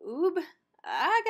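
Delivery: puzzled Scooby mumble; cartoon dog asking. A narrator's reading. Scooby muffled answer.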